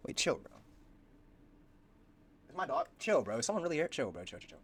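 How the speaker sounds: self-soothing, fading to a near hush; mock calming down.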